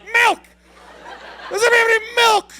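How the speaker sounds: high, loud, fast blurt; stoned shout for milk; blurting loudly. Retold or imitated. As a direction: burst in demanding milk, way too loud. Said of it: "walked in like".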